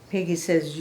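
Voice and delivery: usual speaking voice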